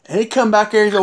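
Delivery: normal talking voice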